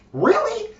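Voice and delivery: high-pitched disbelieving question; incredulous squeak. Acted. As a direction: squeak it in mock astonishment. Incredulous reaction to the whisper.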